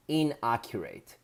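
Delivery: ordinary delivery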